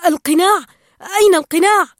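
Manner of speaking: high, loud agitated shouting; frantic cry. A narrator's reading. Marlin hunts for the lost mask, frantic loud cry. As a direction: call out in alarm, pitch jumping up. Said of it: "ينظر حوله في اهتياج ويقول".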